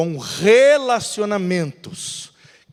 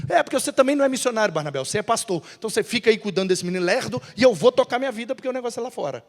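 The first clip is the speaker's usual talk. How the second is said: Paulo apressado; much faster than usual. Acted. Paulo retruca a Barnabé.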